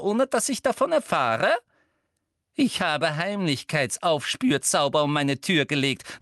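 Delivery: high, loud scolding that sinks at the end; outraged official berating an intruder; menacing schoolmistress. A narrator's reading. Umbridge menacingly scolds captured Harry.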